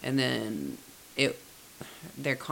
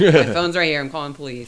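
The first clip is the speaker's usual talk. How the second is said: loud; 2 semitones higher, 13 dB louder, faster than usual. Retold or imitated. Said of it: "I'd be like".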